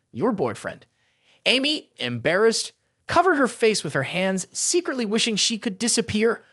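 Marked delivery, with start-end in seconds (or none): 0.1-0.9 put-on "your boyfriend" set apart, pitched low; purposeful emphasis. A narrator's reading. Friend teasing about the presenter, "your boyfriend" deliberately emphasized. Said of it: "She purposely emphasized the words".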